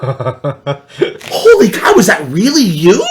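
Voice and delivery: drawn-out, swooping high mock amazement; mock astonishment. Survivor player teasing a fan at a store, mock astonishment. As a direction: overplay the starstruck question.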